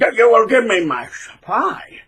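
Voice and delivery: slow, drawn-out villain voice; mock narcissist demand. Mocking the narcissist demanding supply. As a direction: ham up the greedy narcissist.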